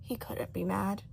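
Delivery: plain reading voice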